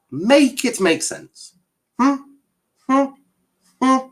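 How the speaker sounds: demanding. Real, not acted. Streamer presses the viewer to justify himself, demanding. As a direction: push the viewer for an answer.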